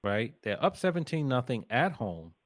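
ordinary talk, nothing marked

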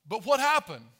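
usual speaking voice

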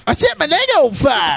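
high shouted whoop; clip shout. Acted. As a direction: yell it like a wild party clip. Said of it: "reenactment of Dub's call".

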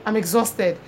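ordinary talk

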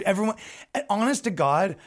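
normal talking voice

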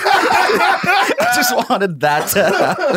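high, slow words squeezed through laughter; cracking up mid-sentence; helpless laughter. Real, not acted. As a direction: laugh hard while getting the words out. Cracking up over grape clip.

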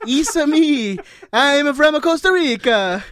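high, loud, drawn-out mock Italian; Mario-style Italian accent. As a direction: belt it out like a cartoon Italian plumber. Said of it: "It's Italian".

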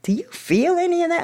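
high, probing sponsor voice; imitating the sponsor. Sponsor pressing her about feelings, high probing voice. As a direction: lift the voice way up like a prying sponsor.

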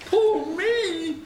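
very high, held wail; crying out woefully. A narrator's reading. Wagoner lamenting his dead horse, high wail. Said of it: "he cried".

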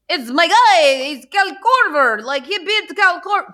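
put-on high, loud, clumsy Greek-flavoured Giannis accent; a self-mocking bad Greek accent for Giannis. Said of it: "Do you hear how horrible his accent is?".

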